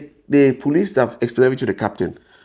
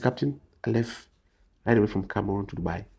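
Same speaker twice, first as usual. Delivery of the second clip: sincere plea; 6 semitones lower, 10 dB quieter than usual. Retold or imitated. Pleading sincerely with captain.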